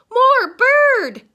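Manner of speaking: put-on very high, loud toddler yell; baby crying out. A narrator's reading. Baby Joshua points at the pigeons, crying out. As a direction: shout it high like a little one demanding. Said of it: "he cried".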